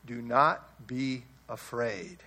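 low, soft and slow reassurance; a tender, hushed comfort; gentle Jesus. Acted. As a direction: lay a hand on him with your voice. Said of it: "put his right hand on him".

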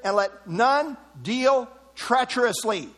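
"none deal treacherously" slowed and spaced out; emphatic scripture reading. Shown as a demonstration. Preacher reading Malachi's warning to husbands, emphatic. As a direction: punch "none", then stretch out "deal treacherously". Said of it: "Note this emphasis".

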